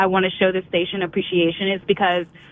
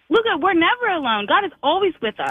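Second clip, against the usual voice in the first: little daughter's voice; 7 semitones higher than usual. Retold or imitated. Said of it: "And she was like".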